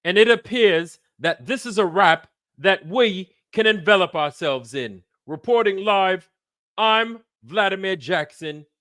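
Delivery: high, projected fake British reporter; bullshit British accent. British reporter signing off live to the studio, mock British accent. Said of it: "my bullshit British accent trying to act like a British reporter".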